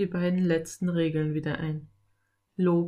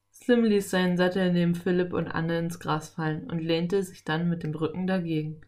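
ordinary delivery, nothing marked

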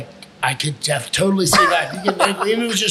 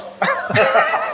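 high laugh. Real, not acted. Laugh after retold taunt.

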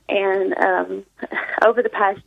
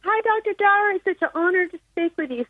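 excited; 9 semitones higher than usual. Real, not acted. Caller thrilled to reach host.

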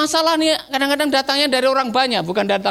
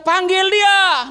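high, drawn-out order; commanding call; 7 semitones higher, 3 dB louder, much slower than usual. Acted. Jesus ordering the crowd, high commanding call. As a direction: call it out slowly, pitched up. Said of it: "Dia bilang".

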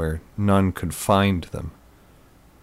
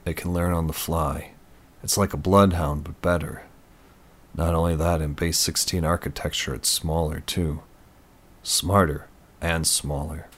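understated expert, slightly lowered voice; admiring tech expert. A narrator's reading. Voices Eric in the story.

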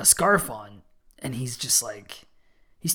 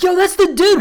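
excited recognition; 8 dB louder than usual. Retold or imitated. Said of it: "I was like".

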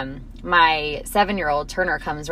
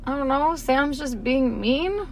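put-on slow, higher pouty boy voice; sulky child; 5 semitones higher, 4 dB quieter, much slower than usual. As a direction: drag it out like a sulking kid.

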